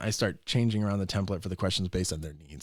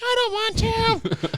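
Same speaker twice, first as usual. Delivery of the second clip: put-on high, slow kid whine; high little child refusing; whiny child; 5 dB louder, much slower than usual. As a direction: drag it out like a small boy. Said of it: "the kid's like".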